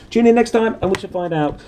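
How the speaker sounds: high, loud announcer farewell; show sign-off. Acted. Reader signing off to listeners like a jolly announcer. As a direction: sign off like a radio host. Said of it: "sign-off".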